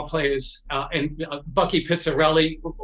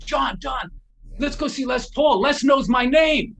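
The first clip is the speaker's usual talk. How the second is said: higher, eager urging, building louder; excited boast; 6 semitones higher, 4 dB louder than usual. Retold or imitated. Guitarist rallies his friend John to go meet his idol, excited. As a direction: pitch it up, proudly rallying a pal.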